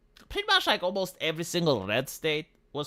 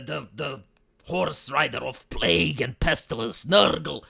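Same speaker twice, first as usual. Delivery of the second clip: lower, slowed doom-laden proclamation; doomsday prophet; 4 semitones lower than usual. Retold or imitated. Mocking the president's pandemic warnings, as a slow doomsday prophet. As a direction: drag out each plague word like a prophet of doom. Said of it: "like, the president".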